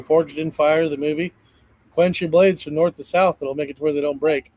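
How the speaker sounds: usual speaking voice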